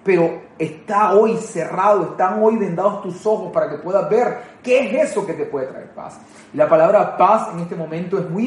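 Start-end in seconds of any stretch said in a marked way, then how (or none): 0.0-5.7 slow tender quote. Acted. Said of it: "y dice". 5.7-6.2 whispered ending. Acted. Jesus's lament ends in a hush.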